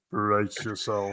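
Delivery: slow, low Mister Bean drawl; slow deadpan Mr Bean voice; Mr Bean impression. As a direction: drag it out like Mr Bean. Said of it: "Like Mister Bean".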